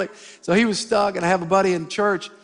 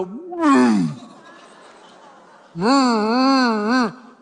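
engine noise; 3 semitones higher, 3 dB louder, much slower than usual. Retold or imitated. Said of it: "and we go".

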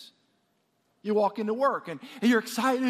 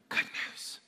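whispered; 9 dB quieter than usual. Acted. Sermon emphasis.

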